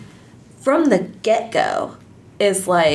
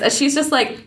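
ordinary talk, nothing marked

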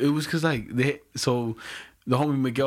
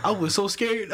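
ordinary talk, nothing marked

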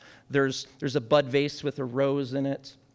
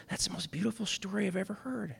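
softly voiced, higher, as if moved; soft wonder; 4 semitones higher, 7 dB quieter than usual. Old woman to the man who read her the story, softly awed. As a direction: retell her awe gently and quietly.

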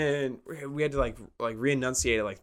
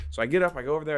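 ordinary talk

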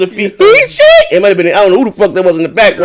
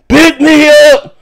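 imitating drunk friend; 9 semitones higher, 5 dB louder, slower than usual. Drunk friend voiced asking ride.

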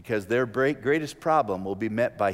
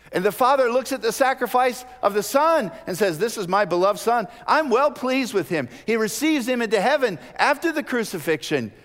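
loud, high sermon proclamation; fervent preached narration; preaching at full force; 10 semitones higher, 5 dB louder than usual. Acted. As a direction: announce the Father's approval. Preaching the Father's voice.

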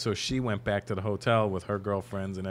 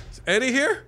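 put-on eager kid; 6 dB louder than usual. Boy asks at hotel door.

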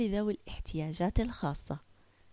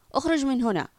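loud, higher order to get out; harsh order; 4 semitones higher, 7 dB louder than usual. Acted. Lifeguard ordering Yusuf out, harsh and loud. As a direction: throw him out, louder.

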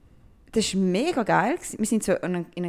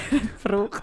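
ordinary talk, nothing marked

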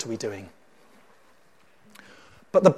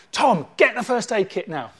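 higher-pitched urgent order to a crewmate; acted emergency command; urgent call; 6 semitones higher than usual. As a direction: call out for help without delay.

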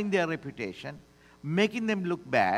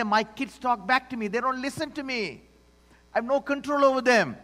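higher, louder exasperated complaint; mock frustrated parent; 6 semitones higher, 3 dB louder than usual. Pastor playing a parent complaining about the kids: high, loud exasperation. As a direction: vent like a fed-up parent. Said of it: "Or you might say".

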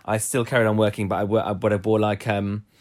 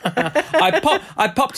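laughing; slower than usual. Real, not acted. Laughing at prostate joke.

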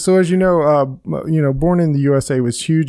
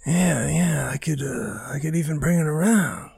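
[name] impression; 7 dB quieter than usual. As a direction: mumble it like a brooding rocker. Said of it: "That's my [name]".

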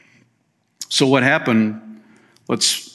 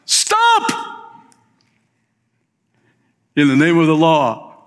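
mock cop shout; 3 semitones higher than usual. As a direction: yell it like a TV cop, a joke take. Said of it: "[name] shouts".

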